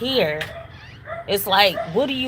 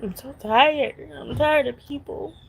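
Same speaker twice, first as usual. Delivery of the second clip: real exhaustion, fighting back crying; close to tears; 4 semitones higher than usual. Real, not acted. Woman tired of people after losing shelter, close to tears. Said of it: "I feel like crying".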